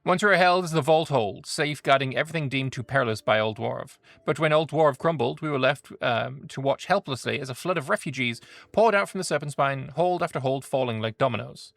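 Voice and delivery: lore read with gravitas; story narration. A narrator's reading. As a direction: narrate a proud dwarf history. Reads faction lore aloud.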